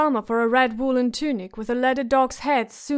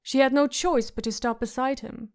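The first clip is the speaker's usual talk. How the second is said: plain reading voice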